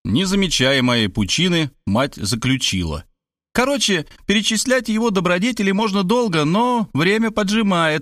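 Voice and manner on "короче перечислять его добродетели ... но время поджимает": high, insistent motherly voice; hurrying mother, pitched up; bossy mother. Acted. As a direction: press the matter in a raised pitch. Narrator voices the boastful mother.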